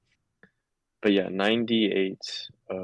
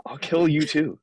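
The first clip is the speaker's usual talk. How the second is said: menacing threat; 8 semitones higher, 4 dB louder than usual. Acted. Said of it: "Intimidation, intimidation".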